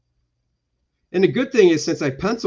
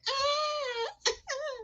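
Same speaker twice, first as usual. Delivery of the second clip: very high, soft, drawn-out squeal; mock swooning squeal; 10 dB quieter than usual. Heroine reacts to He-Man's power, mock squeal. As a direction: imitate a thin, high swooning cry.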